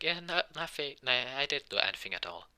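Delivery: evasive denial. Acted. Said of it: "trying to, not to talk about it".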